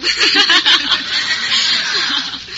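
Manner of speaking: a long, high, loud laugh; drawn-out laugh. Real, not acted. Laughs at brunette joke.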